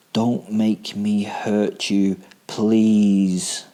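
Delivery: slow, sinister fake politeness; mock-polite threat. A narrator's reading. Killer warning his captive with slow fake courtesy. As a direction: threaten her sweetly.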